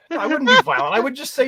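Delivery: high, loud laugh through the words; laughing protest. Real, not acted. Protesting to his cohost while laughing hard.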